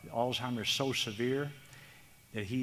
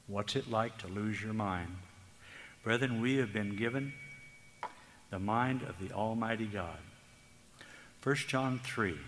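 normal talking voice

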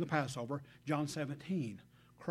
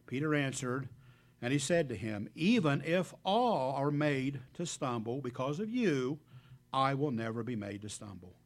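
louder, measured Bible reading; scripture reading; 4 dB louder than usual. Acted. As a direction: proclaim the verses to the congregation. Preacher reads scripture aloud.

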